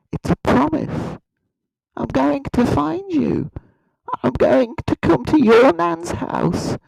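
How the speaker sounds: high, slow, deliberate mock threat; mock menace. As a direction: draw out a silly high-pitched threat with long pauses. Mock threat to non-subscribers.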